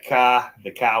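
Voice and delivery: dropped r, car said like ca; imitating Boston car with no r; Boston accent. Agreeing about Boston accents, dropping the r on car. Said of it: "hot car Boston accent".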